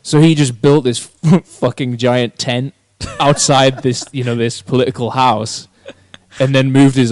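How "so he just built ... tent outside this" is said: usual speaking voice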